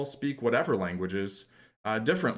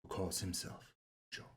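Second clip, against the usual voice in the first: put-on hushed Desperado line, Mexican-accented; Antonio Banderas Mexican accent; 2 semitones lower, 9 dB quieter than usual. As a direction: deliver it softly in a Mexican accent, with long pauses. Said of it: "is Antonio Banderas doing a Mexican".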